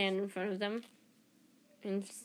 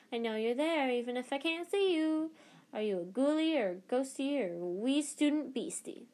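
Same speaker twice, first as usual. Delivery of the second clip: higher, sing-song mischievous ghost; teasing poltergeist; 5 semitones higher than usual. Acted. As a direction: tease like a sly poltergeist. Peeves taunting in the dark.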